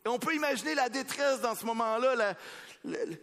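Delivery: high, loud, half-sung preaching; impassioned preaching. Acted. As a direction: swell with the music. Preaching over music.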